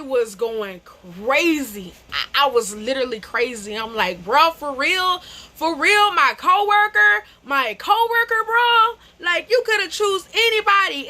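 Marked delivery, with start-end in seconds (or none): 4.2-11.1 high, loud, outraged yelling; rising to a high angry yell at him. Retold or imitated. Yelling at her cheating boyfriend, outraged and high.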